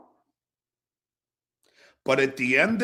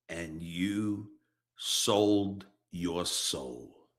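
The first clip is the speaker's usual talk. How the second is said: low, slow and bitter, much softer; quiet contempt; 10 semitones lower, 6 dB quieter, slower than usual. Real, not acted. Condemning Rob for selling out, quiet contempt. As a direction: spit it out quietly, disgusted.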